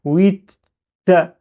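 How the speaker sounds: "Oui" cut off on t, a held gap, then "ta"; shadda. Shown as a demonstration. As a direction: linger on the block before "ta". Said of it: "c'est ça le shadda".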